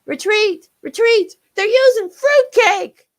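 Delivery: high, loud frantic yelling; terrified soldier screaming retreat; panicked shriek. Acted. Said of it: "Enemy shrieks echoed".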